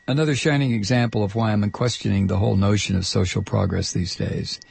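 ordinary delivery

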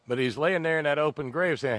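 ordinary delivery

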